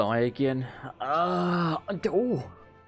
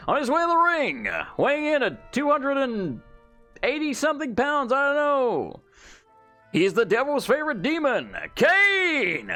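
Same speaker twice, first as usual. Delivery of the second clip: fighting game announcer; 6 semitones higher than usual. Acted. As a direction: build the hype for the entrance. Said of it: "I'm auditioning for W W E".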